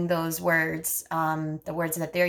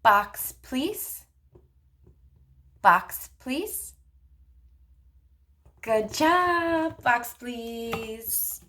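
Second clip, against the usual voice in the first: put-on higher, bright-paced modeling voice for a child; speech therapist modeling turn-taking for a child, sing-song; 5 semitones higher, much slower than usual. Shown as a demonstration. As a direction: model it slowly and playfully for a toddler. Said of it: "you say".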